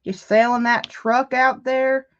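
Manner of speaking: slow Southern country drawl; country accent. Retold or imitated. Neighbor at the door wanting the truck, Southern country accent. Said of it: "people knocking on our doors".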